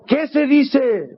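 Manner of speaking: very high and very slow drawn-out prompt; nervous father pressing his silent son, very high and very slow. Retold or imitated. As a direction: plead anxiously, stretching every word. Papá nervioso.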